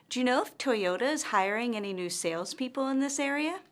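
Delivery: quick yes-no question climbing steadily to "area"; rising intonation. Shown as a demonstration. Teacher demonstrates a yes-no question, voice rising on "area". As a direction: lift the pitch all the way up through "area". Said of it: "her intonation rises at the end".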